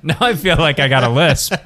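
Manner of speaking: laughing. Real, not acted. Laughing at own lisp.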